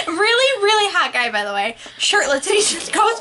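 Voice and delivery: ordinary talk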